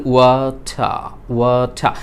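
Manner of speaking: drawn-out British "ওয়াটার" with the final r silent; British "ওয়াটার" ending on an open "tha"; British accent. Shown as a demonstration. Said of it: "শেষের যে র সাউন্ডটি আমরা কি silent করে দিলাম". (British উচ্চারণে "ওয়াটার", র উহ্য রেখে)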